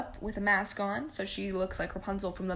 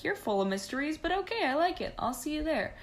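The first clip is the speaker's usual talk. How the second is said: higher, playfully intrigued; charmed prince; 7 semitones higher than usual. Retold or imitated. The prince to the mysterious girl, higher and intrigued. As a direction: lift into a charmed, teasing voice.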